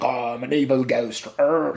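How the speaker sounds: badly faked evil ghost; half-hearted spooky voice with a growl; mock scary ghost. Floating girl trying to act scary, badly faked ghost voice. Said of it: "a little girl trying to act mean and scary".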